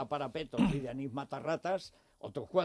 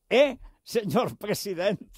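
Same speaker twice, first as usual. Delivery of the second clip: mocking greeting; 6 semitones higher, 8 dB louder, slower than usual. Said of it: "le decía".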